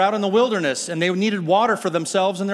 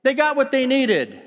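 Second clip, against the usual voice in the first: a bright, high mock "Yay!" cheering on the water; 7 semitones higher, slower than usual. Preacher voices the crowd's naive cheer, mock delighted. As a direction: play the naive onlooker who thinks all is well. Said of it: "And you go".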